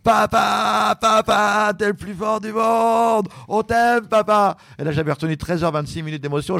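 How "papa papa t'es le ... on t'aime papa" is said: put-on high, slow children's call from the cliff. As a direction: voice the sons shouting love. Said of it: "j'ai entendu en haut de la falaise".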